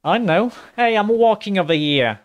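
a hammed-up New York street guy; New Yorker accent. Imitating the game merchant in a loud New Yorker accent. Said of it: "what, a New Yorker accent?".

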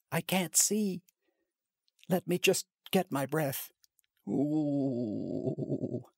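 high breathless whimpering; high tearful gasping. Acted.

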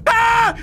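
sharp high startled yell; startled scream. Real, not acted. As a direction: shriek at a jump scare. Jump scare.

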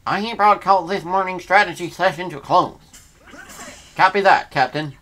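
formal captain read; mock captain announcement. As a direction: proclaim it like a commander. Voicing game characters.

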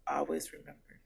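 usual speaking voice

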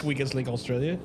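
mock Australian lilt on the caption; lightly put-on Australian accent. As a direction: read it like an Aussie quiz host. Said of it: "Australian accent".

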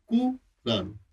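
कुरान broken as कु, pause, रान; split into syllables. Shown as a demonstration. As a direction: pull कुरान apart into its two parts, कु and रान. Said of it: "कु माने आकाश".

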